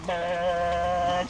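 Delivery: long, droning motor noise; imitated boat motor. Storyteller mimicking a slow little boat, droning motor noise. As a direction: hold one steady monotone drone.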